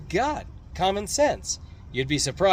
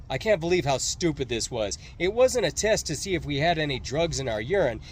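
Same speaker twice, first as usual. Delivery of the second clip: ordinary delivery, nothing marked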